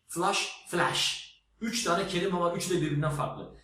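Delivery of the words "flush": first 'flash' with a short 'ah', like flush; A ile okunuş. Shown as a demonstration. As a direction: say 'flash' as 'flush', open central vowel. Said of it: "okunuşu E, A, A gibi". ('flash' kelimesi 'flaş' gibi, A sesiyle)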